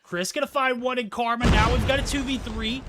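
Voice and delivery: loud, high hyped casting; hyped shout. Real, not acted. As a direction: yell it like a big play. Hyped play-by-play, manager clutch.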